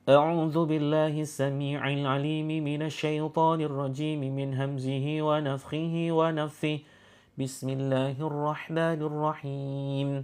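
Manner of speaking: fast, intoned Arabic recitation; Quranic recitation. Acted. Healer begins the recitation over the patients, intoned chant. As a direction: recite the protective formula in a chant.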